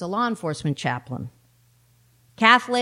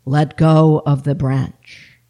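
low, loud, slow divine command; voice of God; 6 semitones lower, 9 dB louder, slower than usual. Acted. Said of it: "said the voice".

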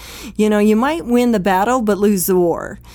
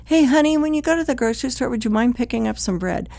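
nice request; 4 semitones higher, faster than usual. Acted. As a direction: ask your spouse lightly and politely. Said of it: "get you a very happy response".

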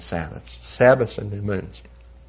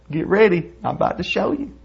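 pitched-up imitation of a challenger; cocky challenger; 10 semitones higher than usual. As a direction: set up the big reveal in a higher retold voice. Retold challenger builds up to reveal.

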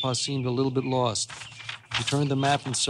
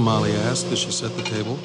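plain reading voice

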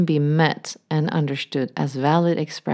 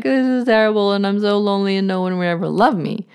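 higher, fast, mock-despairing rush; despairing inner voice; 5 semitones higher, faster than usual. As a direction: rattle off the lonely wound's lament. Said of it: "saying, like".

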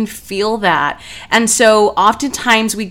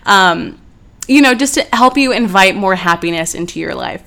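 ordinary delivery

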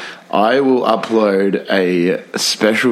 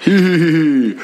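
evil snicker; 7 semitones higher than usual. Acted. Villain snicker.

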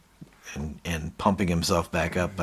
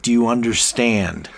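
louder, higher, pressing question; insistent demand; 4 semitones higher, 6 dB louder than usual. Retold or imitated. Boss to shrinking employee, insistent demand.

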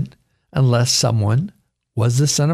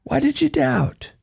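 gentle rebuke; 11 semitones higher than usual. Acted. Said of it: "And he said to Peter".

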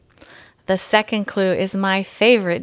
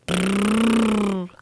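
slow rolled-lip motor brrrr; motorcycle brrrr. A narrator's reading. Boy playing with his toy motorcycle, slow brrrr. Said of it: "sound the boy made".

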